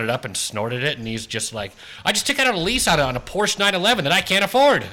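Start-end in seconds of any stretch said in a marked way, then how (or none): 2.0-4.8 higher, amped-up rich bro; manic cocaine guy. Acted. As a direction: rattle it off wired.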